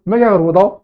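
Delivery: ordinary talk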